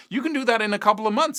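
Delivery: ordinary talk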